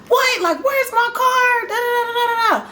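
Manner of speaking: high furious yell; angry shout at the news; going off. Retold or imitated. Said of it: "going off".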